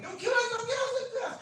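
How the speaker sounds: high, loud terrified pleading; retold panicked scream. Girl screaming at the knife-wielding robber, panicked shriek. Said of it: "she started screaming and shouting".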